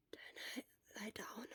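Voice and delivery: faint, soft whispered reply; weak whisper. Acted. Dizzy Shura agreeing to lie down, whispered. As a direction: give in on a breath. Exhausted patient gives in.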